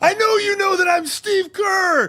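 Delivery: high, full-volume outraged shouting; loud, drawn-out diva meltdown; screaming tirade. Retold or imitated. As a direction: scream it slowly at the whole room, drawing out each phrase. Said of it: "started screaming".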